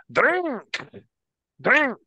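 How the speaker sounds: high, loud drinking chant; shouted urging. Acted. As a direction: egg a drinking buddy on at high pitch. Drinker urging her to chug.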